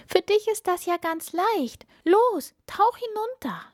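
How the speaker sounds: very high, eager princess voice; happy urging. A narrator's reading. Princess sending the frog down the well, happy urging. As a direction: urge brightly, pitched right up. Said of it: "rief die Prinzessin froh".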